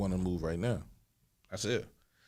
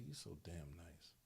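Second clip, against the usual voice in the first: quiet; 5 semitones lower, 22 dB quieter, slower than usual. Retold or imitated. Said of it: "she's like".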